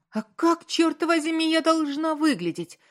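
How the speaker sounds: high, loud outburst of fury; angry cry. A narrator's reading. Wife blinded by fury at her husband's insult, angry cry. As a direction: cry out in indignant rage. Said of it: "и Анна вскричала".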